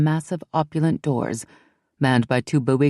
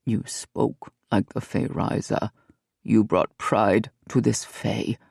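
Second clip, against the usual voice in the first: low, slow, quiet proud murmur; a stern guard quietly proud; solemn warrior; 5 semitones lower, 4 dB quieter, slower than usual. Acted. Belliard praises Ellie.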